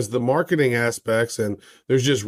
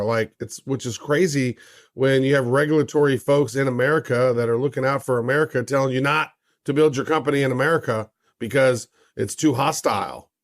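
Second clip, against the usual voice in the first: usual speaking voice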